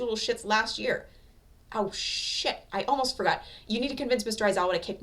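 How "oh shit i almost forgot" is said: ordinary delivery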